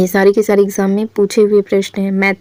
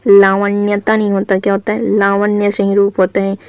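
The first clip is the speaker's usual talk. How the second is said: ordinary talk, nothing marked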